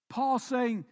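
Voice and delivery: usual speaking voice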